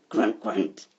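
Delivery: very low, loud piggy grunts; grunting pigs. A narrator's reading. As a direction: grunt the words like a pig. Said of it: "went the pigs".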